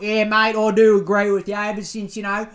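loud, blokey bogan rant. Retold or imitated. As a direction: play a fired-up country bogan agreeing with the host. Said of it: "out in Burke listening to this being like".

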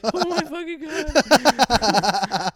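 high, slow, laughing oh my God; cracking up while acting caught; laughing dismay. Retelling getting caught, laughing slowly.

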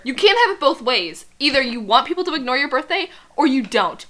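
hammed-up scolding boyfriend; Edward scolding. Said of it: "says to her".

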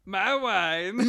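high, loud bad accent impression; hammed-up Borat accent. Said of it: "it's not even good Borat".

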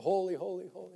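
preacher quoting the tape, hushed; imitating Brother Branham. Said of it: "Brother Branham would even say on tape".

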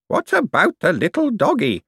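put-on high old-lady voice; elderly voice. A narrator's reading. Aunt asking about the dog, high elderly voice. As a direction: pipe up like a little old lady. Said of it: "said an elderly voice".

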